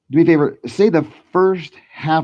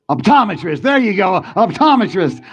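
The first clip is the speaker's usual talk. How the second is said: raised, excited announcement; triumphant reveal; 6 semitones higher, 4 dB louder than usual. Real, not acted. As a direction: proclaim it high, like a trick landed. Triumphant reveal after failed trick recovers.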